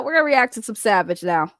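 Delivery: ordinary talk, nothing marked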